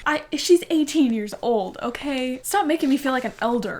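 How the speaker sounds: ordinary delivery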